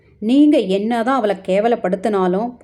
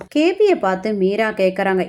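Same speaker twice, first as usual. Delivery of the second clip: plain reading voice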